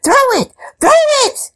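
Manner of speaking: high, loud excited shriek; shrieking cats. A narrator's reading. Cats shrieking with joy at the ball. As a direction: squeal it out like thrilled cats. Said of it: "shrieked the cats".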